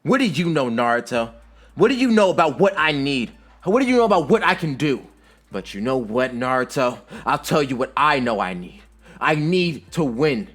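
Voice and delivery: heated, high-pitched angry retort; yelling back. A narrator's reading. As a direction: yell back, rising to an outraged peak. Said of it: "Sasuke yells back at Naruto".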